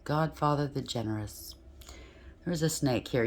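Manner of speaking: normal talking voice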